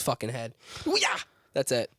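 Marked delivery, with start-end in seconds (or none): 0.9-1.2 high whooping ooh-yah; high playful whoop; mock cheer. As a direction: cheer the hit. Cheering dog's swat.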